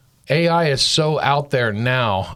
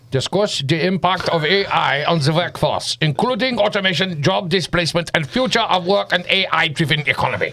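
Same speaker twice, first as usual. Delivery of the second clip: German accent; 5 semitones higher than usual. Shown as a demonstration. Joking about German, reads the topic in a German accent. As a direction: read it like a stiff German, "the" as "ze". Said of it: "if it's German, it would be".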